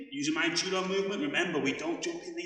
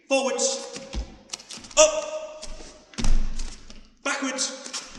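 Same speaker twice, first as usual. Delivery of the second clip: shouted commands; 9 semitones higher, 6 dB louder, slower than usual. Real, not acted. Coach calling drill directions.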